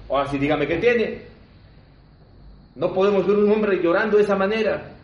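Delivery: loud, acted demand out of concern; forceful imploring; insistent pleading. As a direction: press him for an answer, rising in pitch. Onlookers insist he explain his crying.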